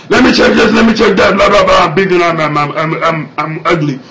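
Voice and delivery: loud, exaggerated caricature out of the falsetto; mocking gruff lady. Hospital lady checking a patient, loud mocking caricature. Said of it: "she was not like this".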